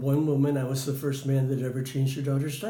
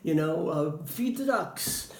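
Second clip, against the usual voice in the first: put-on higher, coaxing singsong to a child; playful suggestion; 5 semitones higher than usual. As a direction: make the park sound fun. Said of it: "And I said".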